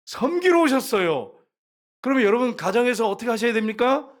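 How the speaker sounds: loud, high pulpit exhortation; forceful preaching. Real, not acted. Preacher challenges couples to serve each other, forceful preaching. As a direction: demand an answer, then declare it emphatically.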